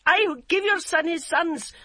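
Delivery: high, loud furious yell; cried out. A narrator's reading. Yum shrieking at her mother-in-law, loud and high. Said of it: "she cried".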